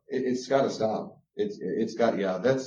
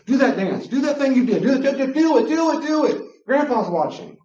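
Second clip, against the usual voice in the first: high, loud nagging chant of do it; mock pushy parent; 11 semitones higher, 5 dB louder than usual. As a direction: egg the kid on like a pushy parent. Mimicking parents pushing kids to perform.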